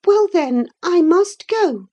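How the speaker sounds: put-on high, loud girl, slowing at the end; hurt girl. A narrator's reading. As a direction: announce it reluctantly, wanting to be stopped. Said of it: "she repeated".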